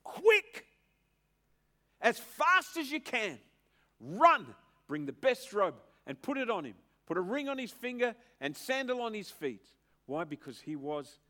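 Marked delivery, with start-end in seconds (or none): 0.0-9.7 shouted father's order, high and loud; urgent command. Acted. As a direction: bellow at the servants to hurry. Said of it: "said to his servants".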